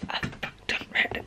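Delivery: sneak the swear word out in a whisper; breathy aside, hushed like it's naughty. Real, not acted. Booktuber whispering a swear to her viewers. Cheeky swear mid book plans.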